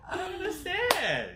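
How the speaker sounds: high-pitched mock wail through laughter; very high, half-laughing whine; playful laughing protest. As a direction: squeal it in mock bewilderment, laughing. Playfully protesting her doubting his skills.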